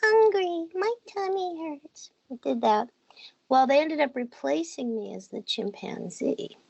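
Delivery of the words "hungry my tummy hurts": put-on tiny high, slow baby animal whimper; high little kitten voice. Shown as a demonstration. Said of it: "you can do the kitten's voice".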